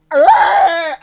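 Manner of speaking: big mock shout of shock; startled yell. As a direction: yell like possessed. Guy reacting dramatically to nod.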